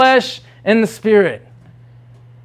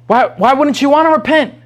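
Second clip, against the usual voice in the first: normal talking voice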